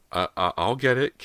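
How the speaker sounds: put-on girl hesitantly offering to fetch the sledgehammer. A narrator's reading. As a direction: stammer it out unsure. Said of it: "Callie said hesitantly".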